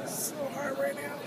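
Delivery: tired crashing voice; caffeine crash. Real, not acted. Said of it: "Like, this is my voice".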